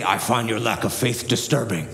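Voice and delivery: a low, mild Darth Vader menace; weak attempt at a booming villain; James Earl Jones impression. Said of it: "the worst James Earl Jones impression".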